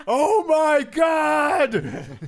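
loud high shout through laughter; laughing shout. Real, not acted. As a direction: crack up and shout it out. Laughing outburst.

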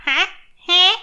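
high confused Huh; mock deaf old person; old person at a party. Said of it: "like that old person at the party".

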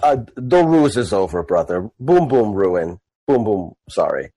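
low, apologetic orc; Boom Boom. Acted. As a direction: confess it low in broken speech. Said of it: "I go".

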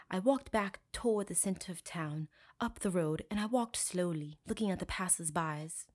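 put-on soft, affected English accent; mock him with a phony British accent; quiet put-on British affectation; fake British accent. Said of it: "'cause this is how he spoke".

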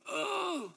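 very high, drawn-out "oh"; exasperated cry. Retold or imitated. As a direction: let out a high mock wail of exasperation. Retelling being overwhelmed, at breaking point.

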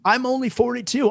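high-pitched, hammed-up disbelief; incredulous protest. Retelling his protest to the doctor.

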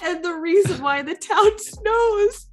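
high, teary voice; a real catch of emotion in the voice; choked up. Real, not acted. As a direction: talk through rising tears. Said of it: "brings tears to my eyes".

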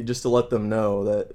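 normal talking voice

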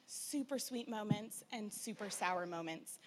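ordinary talk

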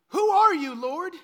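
loud, high bewildered cry; loud startled question. Acted. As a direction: cry out in confusion. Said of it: "Completely bewildered".

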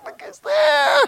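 very high, loud laughing; laughing shout. Retold or imitated. Man laughing with Jesus in heaven, shouting.

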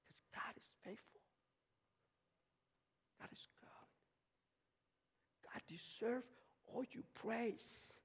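near-whisper, barely any voice; hushed praise. Acted. Preacher to church on why God deserves praise, hushed. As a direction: murmur it far under full preaching voice.

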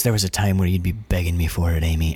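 low, leering taunt; menacing ex-boyfriend. Retold or imitated. Ex-boyfriend Charlie taunting Amy, in a low leering voice. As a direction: lean in low and predatory.

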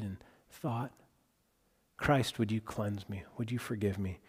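soft, hushed retold prayer; humble prayer. Girl praying for forgiveness with her mom, softly and humbly. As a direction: imitate a child's quiet prayer of confession. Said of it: "Let's get on our knees and pray".

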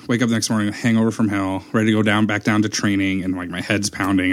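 ordinary delivery

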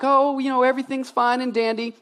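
high singsong mock-cheerful voice; phony high-pitched breeziness; fake cheer. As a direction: put on a fake everything-is-great voice. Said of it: "like, fake".

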